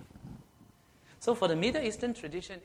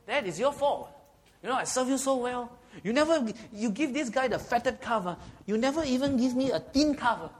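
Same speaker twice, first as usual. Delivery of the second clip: higher, louder, aggrieved son accusing his dad; accusing protest; 7 semitones higher, 4 dB louder than usual. Retold or imitated. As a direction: whine it out like a wronged son blaming his father. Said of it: "He was protesting the mistreatment".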